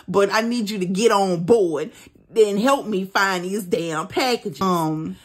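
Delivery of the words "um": normal talking voice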